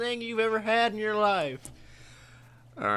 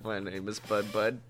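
much lower, quieter mumble; low mopey kid voice; imitating Bud Bud; 9 semitones lower, 8 dB quieter than usual. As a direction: drone it like a deadpan teen. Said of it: "he's just like".

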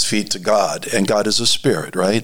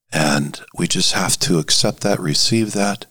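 ordinary talk, nothing marked

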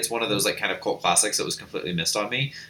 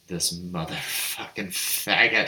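slow, low, muttered mock curse; annoyed professor; 5 semitones lower, 4 dB quieter, slower than usual. Teasing friend voices the professor, slow and low. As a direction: grumble like a fed-up professor. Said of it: "the professor being like".

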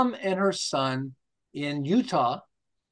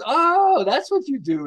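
realising; 8 semitones higher, 5 dB louder, slower than usual. Retold or imitated. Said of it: "he goes".

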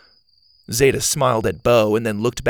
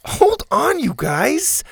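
startled yelp; 4 dB louder, slower than usual. Acted. Zeta bitten by triplets.

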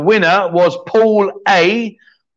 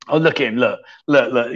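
ordinary talk

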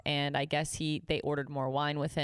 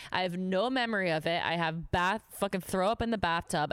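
usual speaking voice

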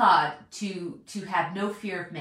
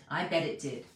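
softer and lower, a knowing aside; quiet repeat; 6 semitones lower, 6 dB quieter than usual. Real, not acted. Preacher echoing himself to the congregation, quietly wry. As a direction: drop it down as a wry echo.

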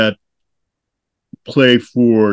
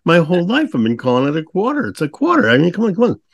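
higher-pitched, incredulous retelling; hammed-up bewilderment; mock disbelief; 7 semitones higher, faster than usual. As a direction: protest as if the word betrayed you. Retells his disbelief over quarter.

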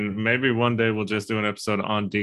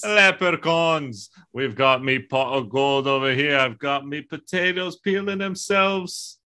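high hammy leprechaun voice; 4 semitones higher than usual. Pretend. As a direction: play a cheeky leprechaun. Leprechaun bit, me pot of gold.

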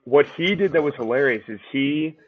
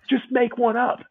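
higher-pitched imitation of an officer; lightly voiced captain giving an order, pitched up; female captain; 10 semitones higher than usual. Imitating the captain during burpees, mock bossy.

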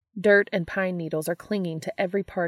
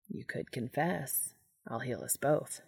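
soft slow angel; 4 semitones lower, 8 dB quieter than usual. Acted. Castiel offering to heal Dean, soft and slow. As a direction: lower and softer, slow offer.

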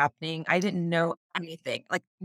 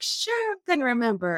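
retold doubtful agreement; 6 semitones higher than usual. Dreamer answering the guide who insists, doubtful mock agreement. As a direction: agree as if humouring someone, pitched up.

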